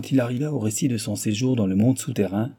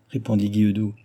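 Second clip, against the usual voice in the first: plain reading voice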